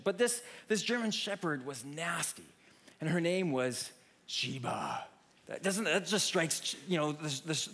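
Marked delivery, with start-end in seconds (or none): 3.0-5.0 slow, dark and ominous; ominous reveal. Shown as a demonstration. Preacher telling the congregation about the scary dog, slow and ominous. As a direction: drop the name like a threat. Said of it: "Let me say that one more time".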